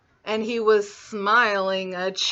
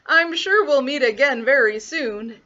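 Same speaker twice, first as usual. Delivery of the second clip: loud, raised farewell; wolf calling after; 4 semitones higher, 6 dB louder than usual. A narrator's reading. Wolf seeing Little Red off, calling out loudly. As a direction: call it after someone walking away. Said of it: "the wolf called after her".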